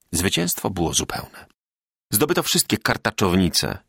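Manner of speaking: plain reading voice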